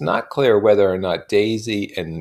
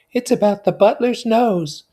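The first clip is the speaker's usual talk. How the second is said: high, loud eager confiding; high excited confiding; enthusiastic whisper; 8 dB louder than usual. A narrator's reading. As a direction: lean in with gossip. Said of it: "she whispered enthusiastically".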